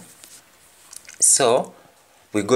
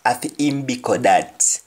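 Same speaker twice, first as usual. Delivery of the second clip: "Afi im biko that" in Jamaican patois, "im" stressed, falling; patois phrase read for learners. Shown as a demonstration. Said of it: "Biko is food".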